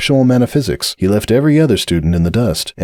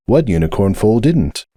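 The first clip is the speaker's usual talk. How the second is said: plain reading voice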